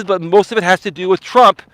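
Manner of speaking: normal talking voice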